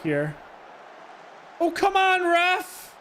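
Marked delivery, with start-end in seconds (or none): 1.6-2.9 pleading with the referee. Real, not acted. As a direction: cry out at the referee in disbelief. Disputing the referee's decision.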